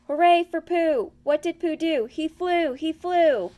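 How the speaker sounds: high, singsong cheer from little Roo. A narrator's reading. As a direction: chant it up at the sky with joy. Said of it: "sang Roo".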